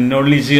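നോളജ് with a short "-ij" ending; correct pronunciation. Shown as a demonstration. Teacher modelling for learners, നോളജ് as "NOL-ij". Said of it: "നോളജ് എന്ന് പറയണം".

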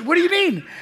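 high-pitched retold disbelief; incredulous. Coworker baffled by her guest's question, imitated incredulity. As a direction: act out a baffled comeback.

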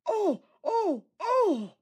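high, loud startled oh's; alarmed cries. A narrator's reading. Engine hit by stones, crying out loud and high. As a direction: cry out in sudden alarm. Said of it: "He called the next moment".

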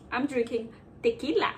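ordinary delivery

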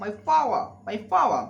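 power said as paa in a British accent, no r, stressed and falling; teacher's British accent demo, power with a dropped r; British English drill. Shown as a demonstration. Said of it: "British English".

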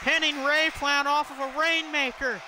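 high, excited play-by-play calling; wrestling commentator. Acted. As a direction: call the big moves hyped up, ringside. Live play-by-play of a wrestling match.

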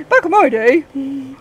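put-on slow, creaky old lady. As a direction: drag it out like a granny. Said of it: "I sound like an old lady".

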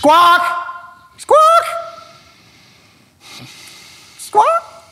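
high screeching parrot squawks. Acted. Parrot impression.

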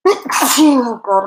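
loud, high sneeze into an exclamation; sneezing fit. Real, not acted. Presenter interrupted by a fit of sneezes, sneezing loudly. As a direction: sneeze hard, then exclaim in exasperation. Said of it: "I'm having a little fit now with sneezes".

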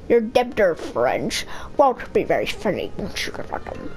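put-on low, wavering old mentor voice; wise elder. Elder Kettle advising the boys, aged mentor voice. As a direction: counsel them like an old-timer, breaking up in places.

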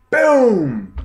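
high, loud "Boom!" like a cry; punch boom. Retold or imitated. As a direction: shout the punch as it lands. Knockout punch lands.

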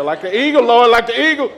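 hammed-up tired parent praying; mock desperate prayer. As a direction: beg the Lord half-laughing for strength. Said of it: "You're gonna".

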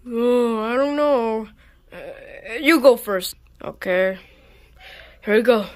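ordinary talk

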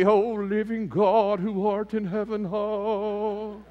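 slow, high, hammed-up churchy prayer voice; mock King James prayer. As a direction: drag it out like an old-fashioned pompous prayer. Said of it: "some of you think it's gotta be".

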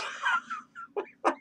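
very high, soft clown shriek-laugh; shrieking clown giggle. Acted. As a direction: squeal like a mad clown. Clown cackle.

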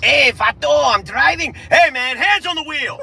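high, shouted mock Mexican accent. Driver warning about the wheel, shouted mock Mexican accent. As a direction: yell it in a hammed-up Mexican accent. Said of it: "you're not from Mexico".

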